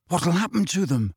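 lightly voiced eager curiosity. A narrator's reading. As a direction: ask eagerly, pitch lifted. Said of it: "said Ron eagerly".

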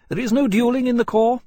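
high, loud, emphatic ruling; firm pronouncement. A narrator's reading. Captain repeating the rule to Lawrence, firm. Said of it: "Portland said flatly".

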